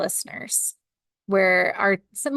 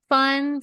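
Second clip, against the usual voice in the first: FUN set apart, higher and drawn out; air quotes on fun; 6 semitones higher than usual. Real, not acted. Cohost on companies planning something for their team, fun in air quotes. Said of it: "I did fun in air quotes".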